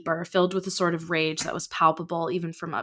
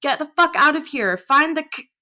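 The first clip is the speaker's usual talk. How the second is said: shouting orders; 9 semitones higher, 7 dB louder than usual. Acted. Shouting orders mid-fight.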